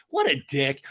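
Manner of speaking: ordinary talk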